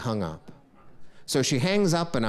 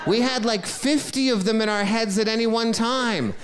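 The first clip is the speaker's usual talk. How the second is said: excited rant; 8 semitones higher, 4 dB louder than usual. Real, not acted. Ranting about remembering phone numbers.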